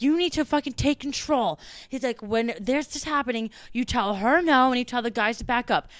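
high, loud furious shouting; retold angry yell. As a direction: scream orders at the cameraman. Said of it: "fucking screams at D".

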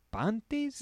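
put-on high, puzzled "Panties?" in a Spanish accent; Spanish-accented impression. Spanish star puzzled by his date's request, imitated Spanish accent. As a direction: question it in an imitated Spanish accent, vowel opened to "pahn-". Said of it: "does this get you the ladies, Holmes, with that German accent".

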